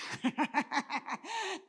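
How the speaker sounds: a real laugh mid-sermon; amused laugh. Real, not acted. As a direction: laugh at your own joke. Laughs at her own joke.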